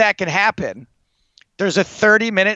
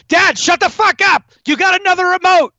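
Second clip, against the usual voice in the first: much higher, louder mock yell at dad; imagined angry outburst; 14 semitones higher, 5 dB louder than usual. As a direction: bark it at dad, fed up, as a pretend blowup. Imagined outburst at dad over remote.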